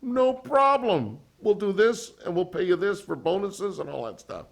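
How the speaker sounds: the boss agreeing with no pause at all; instant yes. Retold or imitated. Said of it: "without taking a breath".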